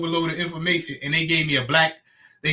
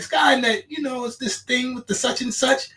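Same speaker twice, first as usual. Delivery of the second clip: asking the AI; 8 semitones higher than usual. Retold or imitated. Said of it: "I'll be like".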